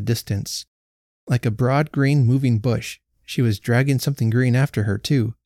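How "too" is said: ordinary delivery, nothing marked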